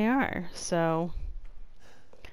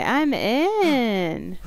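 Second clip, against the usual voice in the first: usual speaking voice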